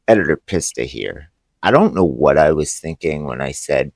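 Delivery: low, hushed correction in a voiceover; editor's voiceover aside. Real, not acted. Editor correcting the twelve-movie plan, in a quiet aside.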